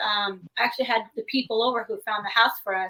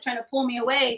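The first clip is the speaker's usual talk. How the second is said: ordinary talk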